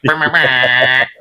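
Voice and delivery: slow, drawn-out buzzer noise made with the lips; mock buzzer sound. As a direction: play a silly soundboard buzzer with your mouth. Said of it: "cue in the soundboard later".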